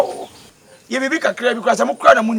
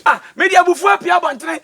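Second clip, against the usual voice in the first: loud, high, furious outburst; angry shout; 8 semitones higher, 4 dB louder than usual. Acted. To the other, storming out in anger, a furious shout. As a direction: throw the words out in anger.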